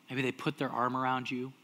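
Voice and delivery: usual speaking voice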